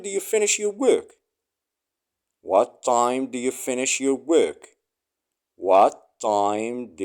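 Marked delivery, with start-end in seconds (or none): none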